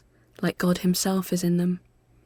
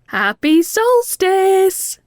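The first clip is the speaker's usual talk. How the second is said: high, slow, loud cheerful call; bright greeting called through a door; 13 semitones higher, 9 dB louder, much slower than usual. A narrator's reading. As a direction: sing it out through the letterbox. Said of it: "Mel calls through the letterbox".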